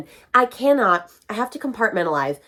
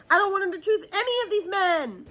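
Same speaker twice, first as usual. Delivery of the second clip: whiny lament; 11 semitones higher than usual. Real, not acted. Lament over the book's romance.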